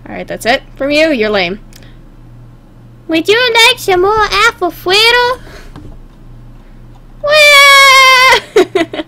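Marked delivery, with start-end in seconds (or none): 3.1-5.4 high-pitched put-on character voice; cartoon pony voice. Voices a pony offering food. 7.2-9.0 high, loud, long cartoon cry; mock wail. Acted pony wail.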